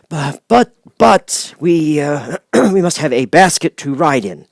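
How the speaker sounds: a nervous humbug who can't get a sentence out; stammering wizard. A narrator's reading. The Wizard stammers his plan to Dorothy, halting. Oz asks basket.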